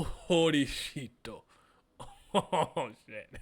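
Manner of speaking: usual speaking voice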